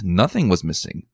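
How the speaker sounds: ordinary delivery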